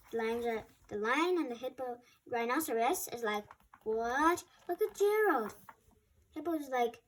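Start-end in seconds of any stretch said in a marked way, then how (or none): 3.8-5.6 high surprised exclamation; amazed. Acted. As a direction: gasp it out in amazement. Lion amazed at Gerald.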